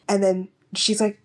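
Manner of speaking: usual speaking voice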